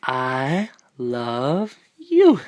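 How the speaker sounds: "I... love... you", very slow, a pause after each word, "you" swooping up. Real, not acted. Parent models words slowly for baby.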